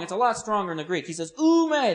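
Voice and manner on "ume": "Ume" pitched high and loud; emphatic negation. Shown as a demonstration. Preacher cites Peter's Greek, emphatic "Ume". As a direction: hit "Ume" hard as a firm no. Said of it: "the strongest, most emphatic".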